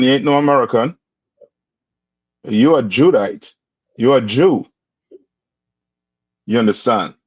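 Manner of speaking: usual speaking voice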